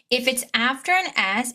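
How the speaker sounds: usual speaking voice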